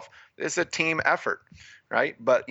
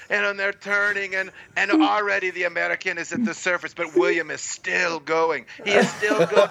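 higher, laughing mock play-by-play; gushing announcer; 7 semitones higher than usual. Retelling how the announcer only praised William, a higher laughing mock commentator. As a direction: build the broadcaster's awe for the star, laughing. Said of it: "So, so then it's just praise".

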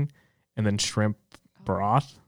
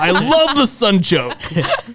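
high, slow laughing echo; drawn out and giggling; laughing mock repeat; 8 semitones higher, 3 dB louder, much slower than usual. Mocking repeat of line 44.

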